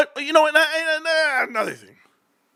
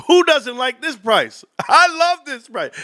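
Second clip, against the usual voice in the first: high, slow gushing delight; excited; 6 semitones higher than usual. Real, not acted. Streamer gushes to viewers about the stock price. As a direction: swoon over the price. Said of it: "I get so excited".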